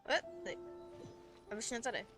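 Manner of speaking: ordinary delivery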